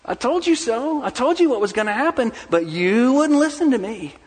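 high, rising told-you-so nag; a frustrated parent reproaching disobedient children; exasperated scolding. Retold or imitated. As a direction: lecture the kids like a fed-up parent. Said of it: "we say".

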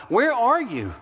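high-pitched, heated questioning; retold prayer of accusation; accusing God. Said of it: "began to accuse God".

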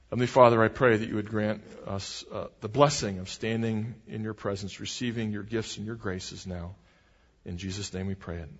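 low-pitched, softening closing prayer. Real, not acted. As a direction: lead the congregation in prayer, pitch dropped.

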